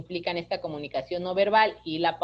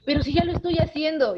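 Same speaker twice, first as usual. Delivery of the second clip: higher, louder defensive whine; whiny teen protest; 8 semitones higher, 4 dB louder than usual. Retold or imitated. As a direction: protest like a fed-up teenager. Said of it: "en ese tono".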